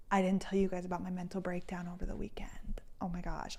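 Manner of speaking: hushed confiding whisper, barely voiced. Real, not acted. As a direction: lean in and tell the secret in a whisper. Confessing aside to viewers.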